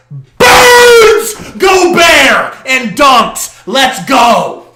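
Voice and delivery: high, loud, stretched-out yell; ecstatic shout. Real, not acted. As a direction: roar as he dunks on him. Calling the dunk live.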